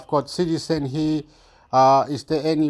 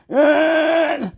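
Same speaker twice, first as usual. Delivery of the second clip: high imitated crying wail; hammed-up high sob; mock crying; 11 semitones higher, 3 dB louder than usual. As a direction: wail like an upset mother. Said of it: "stop crying. You're crying".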